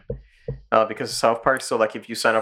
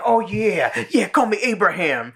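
hammed-up cries of passion, higher pitched; mock ecstatic moaning, on the edge of laughing; mock lovemaking; 8 semitones higher than usual. Mimics Cartman during loud lovemaking.

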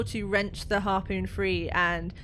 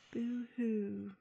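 soft little mock sob; very quiet; 2 semitones higher, 7 dB quieter than usual. Tali after seeming about to speak, very quietly. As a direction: say it small, well under your voice. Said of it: "she just very quietly goes".

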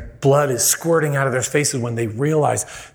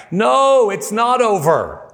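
loud, high-pitched outcry; emphatic rebuttal; 11 semitones higher, 4 dB louder, slower than usual. Retold or imitated. Preacher refuting the driver's shrug, emphatic outburst. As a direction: cut in forcefully, stretching the words high.

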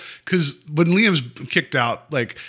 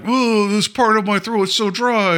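lightly put-on parched grifter, higher and slower; grifter act; 8 semitones higher than usual. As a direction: ham up a dry throat a touch. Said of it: "that grifter thing".

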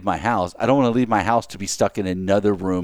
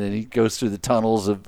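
ordinary talk

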